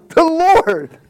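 high, loud startled exclamation; acted-out awestruck outburst; overwhelmed shock. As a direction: cry out as if confronted by God. Acting out a startled awakening.

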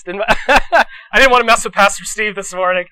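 real amused laughter in the voice; laughing. Real, not acted. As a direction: say it through a laugh. Joking aside, laughing at himself.

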